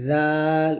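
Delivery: জাল opening with tongue on upper teeth tips, vowel drawn out; touch the teeth tips and lengthen জাল. Shown as a demonstration. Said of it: "জিহ্বাটা উপর যাতে আগে লাগাবো". (দাঁতের আগায় জিহ্বা লাগিয়ে টেনে জাল)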